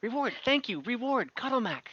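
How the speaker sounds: a lightly voiced little kobold insisting, in halting words; kobold guide.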